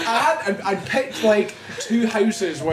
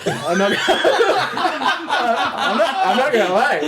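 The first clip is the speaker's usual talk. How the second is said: laughing through it; 5 semitones higher, slower than usual. Retold or imitated. Telling sister he's sad.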